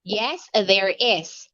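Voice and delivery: slow, careful model answer; slow modelling voice. Shown as a demonstration. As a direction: spell it out slowly for a child. Teacher modelling answer for child.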